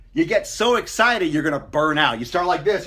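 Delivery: normal talking voice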